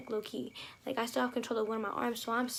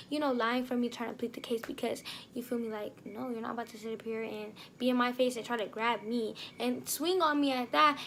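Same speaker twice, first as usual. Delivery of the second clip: ordinary talk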